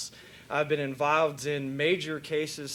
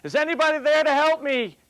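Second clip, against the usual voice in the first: high, loud cry for help; yelling for help; 10 semitones higher, 7 dB louder than usual. Acted. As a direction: shout it down into the canyon, desperate. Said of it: "he's yelling out".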